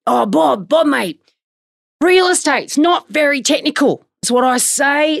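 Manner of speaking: mock a footy guy talking down to his mate; put-on locker-room bloke accent. Host mimics a trainer dismissing technicality to Bob, mocking bloke accent. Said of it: "this is my accent".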